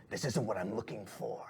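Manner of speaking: whispery, dissatisfied villain; hushed menace, slowing right down on the second sentence; menacing shadowy boss. Acted. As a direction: whisper it slowly with cold displeasure. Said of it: "he throws it in his face and he goes".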